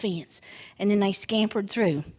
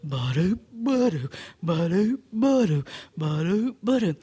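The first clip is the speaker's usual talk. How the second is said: rhythmic lumbering footstep noises; sauntering bear; 5 semitones lower than usual. Acted. Bear walks on, voiced footsteps.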